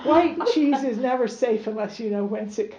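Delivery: real laughter breaking through the reading; can't get the line out for laughing. Real, not acted. Reader cracks up mid-story.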